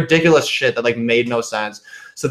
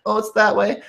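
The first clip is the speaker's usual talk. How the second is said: mock scared Russian girl; 10 semitones higher than usual. Mocking the actress who played a scared Russian girl, high and giggly. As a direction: squeak it out like a pretend-helpless girl. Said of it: "pretended to be this, like, Russian girl".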